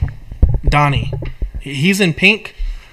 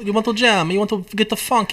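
hammed-up Greek disco come-on; mock Greek club singer's accent; 6 semitones higher, 3 dB quieter than usual. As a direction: put on a playful, high Greek accent. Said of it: "what are we, Greek disco?".

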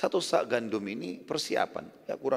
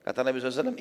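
ordinary talk

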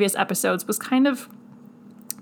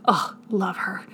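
breathy whispered chef's kiss; hushed admiring aside; whispered praise; 4 dB quieter than usual. Real, not acted. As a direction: savor it in a whisper.

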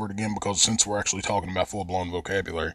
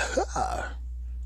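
breathy laugh; 13 semitones higher, 8 dB quieter than usual. Real, not acted.

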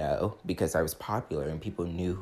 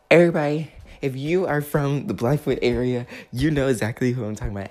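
calling out; 6 semitones higher, 8 dB louder than usual. Real, not acted. Calling out to Blackfoot locals.

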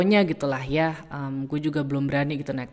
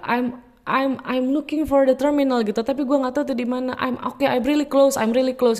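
flustered; 9 semitones higher, 3 dB louder than usual. Retold or imitated. Lost, answering Jo's call.

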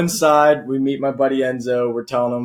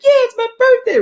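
loud, squealing mock female falsetto; [name]'s drunk voice; 6 dB louder than usual. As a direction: pipe up very high like a drunk putting on a woman's voice. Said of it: "just full high-pitched female".

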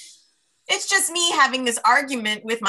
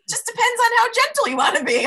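laughing; 5 dB louder than usual. Real, not acted. Laughing at own joke.

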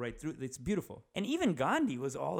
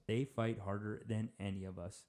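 each word set apart, lower and quieter; 3 semitones lower, 4 dB quieter than usual. Real, not acted. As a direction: repeat it gravely, word by word. Repeats it slowly for weight.

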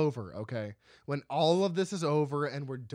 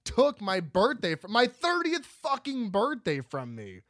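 a worked-up, louder rant about his lost birthday; mock outrage; 6 semitones higher, 5 dB louder than usual. Real, not acted. Host ranting to his co-host about covid, heated. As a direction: vent like it was stolen from you. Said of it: "getting a little spicy".

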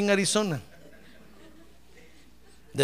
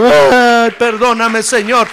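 loud imitation of a weeping plea; retold tearful plea; 10 dB louder, slower than usual. Preacher voicing his brother weeping at the altar, loud mock plea. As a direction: cry out for forgiveness, mock-tearful.

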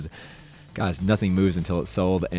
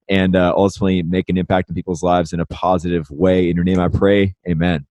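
earnest, somewhat louder prayer; spoken prayer; 7 dB louder than usual. Acted. Host praying over the conversation, earnest prayer voice. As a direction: lead a heartfelt opening prayer.

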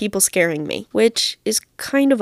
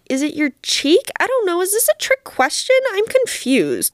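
confused; 10 semitones higher than usual. Retold or imitated. Confused replies to child actor.

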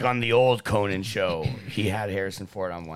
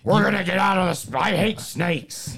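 loud, higher throaty impression; Harrison Ford; 10 semitones higher, 6 dB louder than usual. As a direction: shout it with a rumble in the throat. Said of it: "when he gets that rumble in his throat".

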